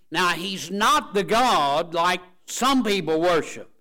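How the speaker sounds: loud, driving preacher's cry; preaching at full force. Acted. Preacher rousing his congregation, loud and fervent. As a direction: preach it hard over the congregation.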